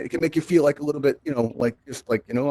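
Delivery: usual speaking voice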